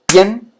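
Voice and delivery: emphatic syllable demo of pien; one-beat syllable demo. Shown as a demonstration. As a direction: hit pien in a single strong stroke. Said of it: "es un solo golpe".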